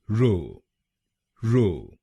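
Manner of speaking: रो said alone, short and clear; first syllable alone. Shown as a demonstration. Teacher drilling the first syllable, रो said crisply. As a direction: isolate रो as a crisp single syllable. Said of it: "पहले शब्दांश को यानी आरओ हम बोलेंगे".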